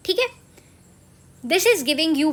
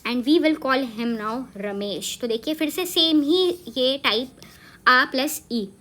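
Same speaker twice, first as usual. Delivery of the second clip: ordinary delivery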